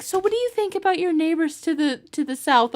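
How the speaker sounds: light mock of a British local asking; imitating a local asker. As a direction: mimic the nosy questioner, quick and high. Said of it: "They were like".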